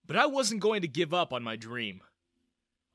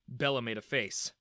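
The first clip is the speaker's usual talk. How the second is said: ordinary delivery, nothing marked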